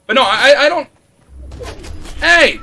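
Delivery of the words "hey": shouting. Real, not acted. Enemy attacks.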